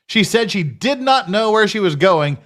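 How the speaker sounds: ordinary talk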